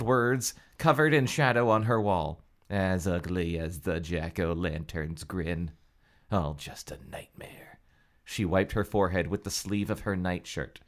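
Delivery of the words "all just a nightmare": breathy relieved whisper. Acted. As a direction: whisper it to yourself. Reader whispers the relief line.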